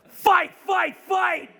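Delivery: high, loud chant of fight fight fight; rallying cry. Retold or imitated. Streamer echoing Trump's cry to his chat, loud and high. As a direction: shout it like a fist in the air.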